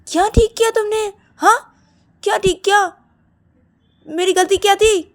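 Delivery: very high, loud demands; outraged challenge. Acted. Siya confronts Shruti over the blame, outraged. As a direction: demand to know your fault.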